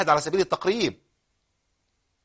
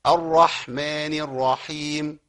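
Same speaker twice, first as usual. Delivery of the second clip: slow, chanted recitation; Quran recitation; much slower than usual. Shown as a demonstration. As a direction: recite it slowly and melodically. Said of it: "حلو قوي". (تلاوة مجودة مرتلة)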